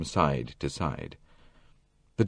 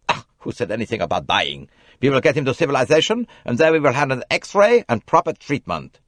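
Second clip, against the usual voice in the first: louder, mildly reproaching doctor; reproachful; 7 semitones higher, 5 dB louder than usual. A narrator's reading. Bessner answering Jackie, in a reproachful doctor's voice. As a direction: scold her a little.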